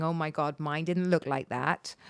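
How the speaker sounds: quoting gossip. Retold or imitated. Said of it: "people freaking out, going".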